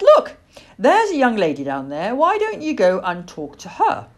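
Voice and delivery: lifted, loud motherly prompting; encouraging mother owl. A narrator's reading. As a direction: coax the child to go and look. Mother owl encouraging her child.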